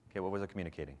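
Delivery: ordinary delivery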